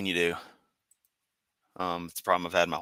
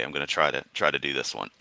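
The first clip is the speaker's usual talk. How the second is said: normal talking voice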